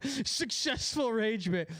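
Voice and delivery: slow, drawn-out words broken by laughter; giggling gloat. Real, not acted. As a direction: relish the win while cracking up.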